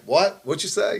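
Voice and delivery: sharp, high startled "What?"; hospital friends hearing he's hungry, incredulous; shocked disbelief. Retold or imitated. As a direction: react like you can't believe your ears. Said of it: "everybody's like".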